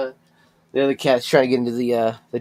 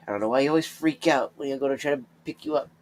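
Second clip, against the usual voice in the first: usual speaking voice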